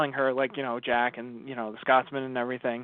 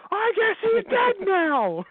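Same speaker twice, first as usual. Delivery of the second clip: high, loud, slow exasperated yell; exasperated outburst; 4 dB louder, much slower than usual. Retold or imitated. Telling a friend how the Scotsman's death hit, yelling high and loud. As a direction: bellow it in comic despair. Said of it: "And I'm just like".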